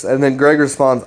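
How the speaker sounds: ordinary delivery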